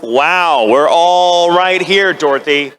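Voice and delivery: loud, drawn-out mock indignation; mock offended. Slighted companions answer Dorothy, hammed-up offence. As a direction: stretch it out, pointedly put out.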